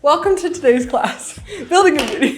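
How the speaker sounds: bright, laughing teacher voice; mock class instructor. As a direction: announce it like a class leader. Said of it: "What class did you go to where your professor was like".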